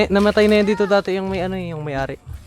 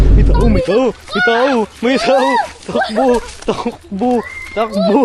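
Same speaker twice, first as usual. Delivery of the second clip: panicked running; 4 semitones higher, slower than usual. Real, not acted. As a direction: shout it while fleeing. Someone seen, they flee. (sigaw habang tumatakbo)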